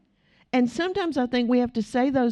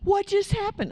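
high-pitched, shocked retold question; imitated freaking out; 11 semitones higher than usual. Housekeeper shocked her words worked, imitated freak-out. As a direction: pitch it way up, acting out disbelief. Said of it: "she's freaking out".

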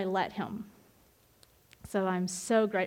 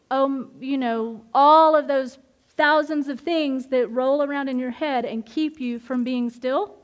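normal talking voice

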